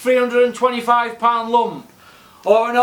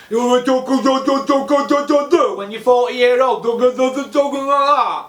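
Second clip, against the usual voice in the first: higher, slurred mumbling of "talk, talk"; mock punch-drunk slur; 3 semitones higher than usual. Mocking what Dave Allen will sound like, slurred. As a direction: mimic a punch-drunk old fighter, words smeared.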